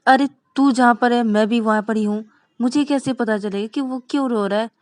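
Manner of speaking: loud, irritated retort; exasperated sister. Acted. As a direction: snap back at a pestering brother. Sister snaps back at Rahul. (झुंझलाकर ऊँची आवाज़ में)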